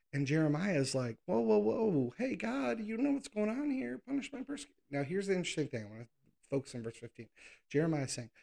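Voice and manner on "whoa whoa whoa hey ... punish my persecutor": high, rising alarmed whine; mock panic, pitch climbing higher; higher and higher frantic plea to God; panicked plea.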